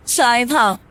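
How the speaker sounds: plain reading voice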